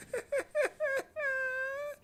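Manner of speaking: breathy high giggle; high squeaky laugh. Real, not acted. To viewers, high squeaky laugh. Laughing after king bit.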